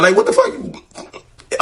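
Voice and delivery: drawn-out, sky-high what the fuck; mock-shocked slow squeal; stunned disbelief. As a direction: let the disbelief stretch the words out, way up high. Shocked at his answer.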